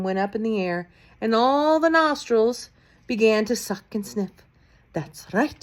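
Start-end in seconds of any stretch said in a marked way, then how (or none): none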